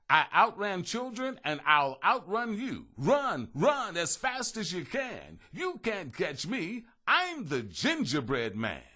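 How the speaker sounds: high, loud, slow singsong boast; calling out taunt. A narrator's reading. Gingerbread man taunting the horse, high slow singsong. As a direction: chant the boast back at the horse. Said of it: "He called out".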